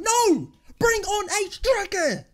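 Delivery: high, loud outraged yell; furious shout. Real, not acted. Fan yelling at the manager for a striker, furious. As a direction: shout the demand, dragging out the words.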